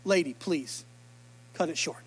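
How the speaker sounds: low, soft, impatient plea; an aside, muttered low; impatient Jairus. Retold or imitated. Jairus urging the woman to hurry, low and impatient. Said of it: "Can you imagine Jairus right now".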